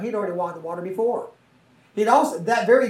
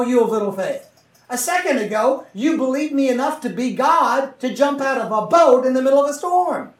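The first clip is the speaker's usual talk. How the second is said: higher, louder rebuke, lightly voiced; Jesus rebuking Peter; 4 semitones higher, 6 dB louder than usual. Jesus chides Peter after he sinks, in a higher, louder rebuke. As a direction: press him on why he doubted.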